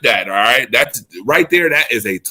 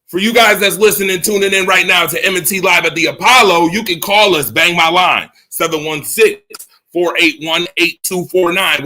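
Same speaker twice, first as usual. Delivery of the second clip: upbeat announcer read; hyped call-in plug; radio host plug; 6 semitones higher than usual. Acted. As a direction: sell the call-in line to listeners.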